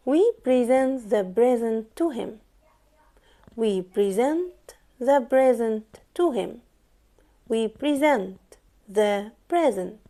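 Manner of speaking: slow, spaced words, "present" two different ways; careful pronunciation model. Shown as a demonstration. Teacher asking learners to notice the pronunciation, slow careful model. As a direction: model each "present" distinctly, slowly. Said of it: "تركزوا معايا كويس جدًا في نطق الجملة دي".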